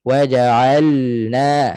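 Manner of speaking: WAJA'ALNA slowly chanted, L sounded before NA; clear lam. Shown as a demonstration. Teacher models the proper reading, clear lam. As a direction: let L flow in waja'alna. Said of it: "ha, macam tu".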